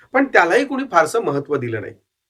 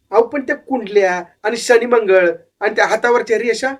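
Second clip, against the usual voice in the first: put-on young boy asking in wonder, higher voice; curious boy; 5 semitones higher than usual. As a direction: ask the old man with awe. Boy asks about horoscopes.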